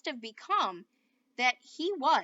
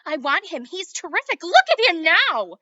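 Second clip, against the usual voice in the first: loud excited protest; eager refusal; 5 semitones higher, 15 dB louder than usual. A narrator's reading. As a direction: gush over the cowboy, refusing.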